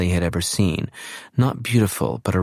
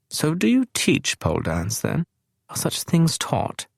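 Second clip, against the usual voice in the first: posh puzzled question; 9 semitones higher than usual. A narrator's reading.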